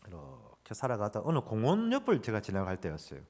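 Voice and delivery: ordinary talk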